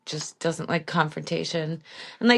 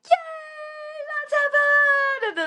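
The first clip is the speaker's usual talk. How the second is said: high, squealed mock delight; mock gushing cheer; much slower than usual. Mocking Ashley's sudden happy squeal, high mock delight. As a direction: gush it like a fake-happy cheer. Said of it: "one minute she's like".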